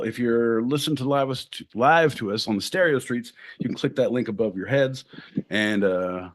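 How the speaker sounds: normal talking voice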